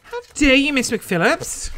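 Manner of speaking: high indignant protest; mock affront. Former student scolding an absent teacher over grades, high mock affront. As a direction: pitch up, acting scandalised at a teacher.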